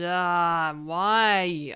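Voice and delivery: loud exasperated why; frustrated outburst. Real, not acted. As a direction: cry out in frustration.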